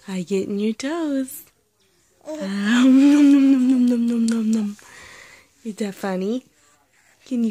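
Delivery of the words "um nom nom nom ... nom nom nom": playful loud chomping noises; nom nom chomping. Pretend. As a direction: gobble the toes up, fading out. Parent chomping on baby's toes.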